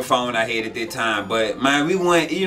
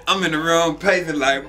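ordinary delivery

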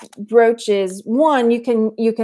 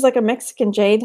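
normal talking voice

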